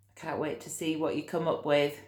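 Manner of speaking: ordinary delivery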